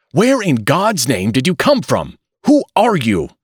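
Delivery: high, loud, frantic demand; frantic outburst. A narrator's reading. Father confronting his strange newborn, frantic and loud. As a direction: shout in alarm at the strange baby. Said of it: "burst out Mister Button frantically".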